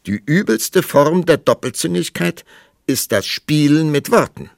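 high, loud declaration; indignant rebuke. A narrator's reading. King rebuking queen for wordplay, loudly indignant. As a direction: deliver it as a loud, lofty reproach.

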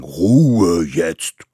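very loud, rumbling order; growled command. A narrator's reading. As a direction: silence the quarrel with a roar. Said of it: "grollte es". (laut grollender Befehl)

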